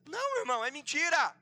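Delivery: loud, high commanding delivery; fiery preaching. Real, not acted. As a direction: proclaim it forcefully to the church. Preaching at full force.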